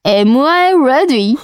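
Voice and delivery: slow, exaggerated native English accent; native English pronunciation. Shown as a demonstration. Reading out what the translator app said, in exaggerated native English. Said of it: "ぜひネイティブ発音を参考にして".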